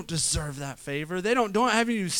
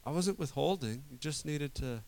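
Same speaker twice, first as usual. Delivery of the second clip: much softer, tender reply that fades almost to nothing; a father softly reassuring his son; gentle father; 3 semitones lower, 12 dB quieter than usual. Retold or imitated. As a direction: hush it like a patient parent. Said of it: "All the father wanted".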